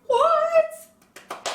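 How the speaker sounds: very high, loud squeal; amazed squeal. Real, not acted. Vlogger amazed she landed the collab, squealing. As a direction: shriek it in playful disbelief. Mock disbelief at own collab.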